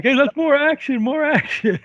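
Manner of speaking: high-pitched, eager director egging them on; mock director's call. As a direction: call out like an excited film director. Acting out director urging the cast.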